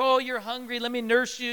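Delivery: gentle imitation of mothering; caring mother. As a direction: fuss tenderly over a hungry baby. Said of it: "they're nourishing and caring".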